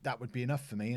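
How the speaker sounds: usual speaking voice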